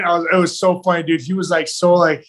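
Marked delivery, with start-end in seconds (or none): none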